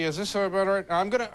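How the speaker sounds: usual speaking voice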